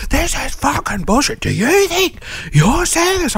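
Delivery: high-pitched furious rant, hammed up; mock rage rant. Raging at the phone alone.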